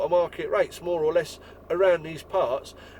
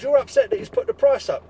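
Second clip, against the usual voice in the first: incredulous; 5 dB louder than usual. Retold or imitated. Incredulous question to the customer.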